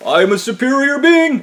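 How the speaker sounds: high, puffed-up mock declaration; hammed-up pompous protest; self-important whine. Manager insists he is above blame, high pompous whine.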